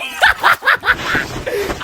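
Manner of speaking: high villain laugh; long hammed-up evil laugh; gloating cackle. Real, not acted. Gloats after boast.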